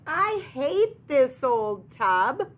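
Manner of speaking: loud, high, slow storybook wail; hammed-up cow complaint; complaining cow. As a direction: cry out a drawn-out protest as the cow. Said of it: "the cow cries".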